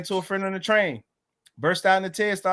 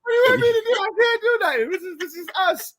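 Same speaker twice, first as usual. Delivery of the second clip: high-pitched tearful wail in retelling; hammed-up helpless sobbing plea; mock crying; 13 semitones higher, 5 dB louder than usual. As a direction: wail it loudly, then ease off. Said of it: "I'm crying".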